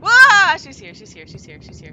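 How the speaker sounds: loud high startled cry, then falling fast; startled yell. Real, not acted. Player warns partner she's here, startled yell. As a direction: yelp in fright as she appears. Ghost appears in the game.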